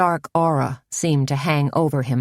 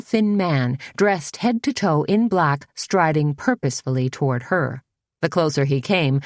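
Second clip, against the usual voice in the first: ordinary delivery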